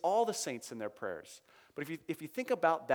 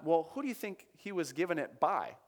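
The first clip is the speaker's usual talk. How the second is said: normal talking voice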